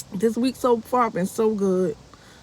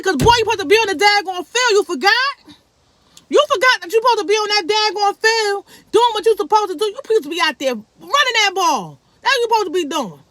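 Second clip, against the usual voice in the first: high, loud, fast telling-off of her son; mama laying into her boy; a mother scolding her son for skipping football; 9 semitones higher, 3 dB louder than usual. Retold or imitated.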